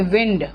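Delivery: विंड with short i, low and falling; noun pronunciation. Shown as a demonstration. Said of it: "pronunciation विंड रखते हैं".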